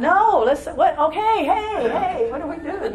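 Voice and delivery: high, laughing, bubbling over; giddy eagerness. Retold or imitated. Eager newcomer joining the worship, laughing and high. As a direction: throw yourself into the fun.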